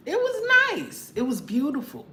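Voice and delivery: high, sung-out it was nice; recalling the candles, gushing high. Real, not acted. Gushing about anniversary candles.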